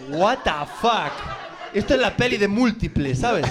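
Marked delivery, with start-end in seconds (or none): 0.1-1.1 slow, drawn-out disbelief; baffled what the fuck. Retold or imitated. Passenger baffled by the sudden accent change, slow disbelief. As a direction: let it hang, puzzled and amused.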